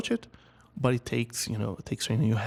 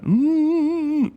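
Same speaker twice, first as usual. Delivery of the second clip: high hum pushed up into the head; blocked-mouth head hum showing the wrong resonance; heady hum demo; 6 dB louder than usual. Shown as a demonstration. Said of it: "the resonance feels very heady when you do this".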